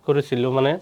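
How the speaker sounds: ordinary delivery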